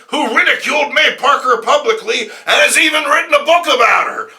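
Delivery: high, loud blustering boss shout; yelling editor. Acted. J. Jonah Jameson yelling at Robbie about Parker. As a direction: bellow at the staff in a rage.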